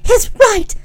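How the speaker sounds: high, tight, strangled cry. A narrator's reading. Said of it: "speaking in a strange, strangled voice".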